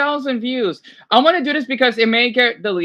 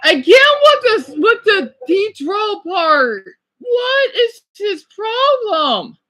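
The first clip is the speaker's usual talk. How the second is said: higher, slow, bewildered and close to tears; upset disbelief; 7 semitones higher, much slower than usual. Real, not acted. Reactor appalled at the man in the clip, slow and upset. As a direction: drag the words out in dismay.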